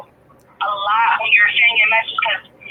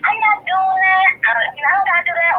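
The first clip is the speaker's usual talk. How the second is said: higher-pitched imitation of a refusing coworker; mock refusal; 7 semitones higher than usual. Light-skinned coworker refusing to do her work, mock refusal. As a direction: mimic a coworker brushing off work, voice raised in pitch.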